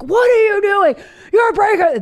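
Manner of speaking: high, loud mock shriek; frantic parent yell. Parent overreacts to toddler spilling pasta, mock-frantic shout.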